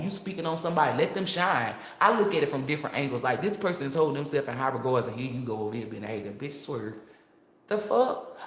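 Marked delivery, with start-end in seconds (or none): none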